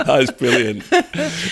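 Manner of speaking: high, laughing delight; real laughter. Real, not acted.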